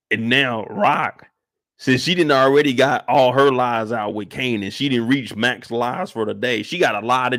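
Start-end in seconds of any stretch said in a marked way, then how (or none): none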